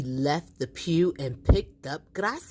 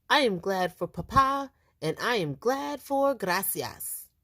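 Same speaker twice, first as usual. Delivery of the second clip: put-on high, earnest child voice; child's toast; 6 semitones higher than usual. A narrator's reading. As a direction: toast like a proud boy. Boy's toast at dinner.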